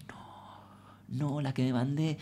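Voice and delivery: hushed horrified whisper. Retold or imitated. Comedian whispering her panic on realizing the blunder. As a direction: whisper it like you just realized the mess.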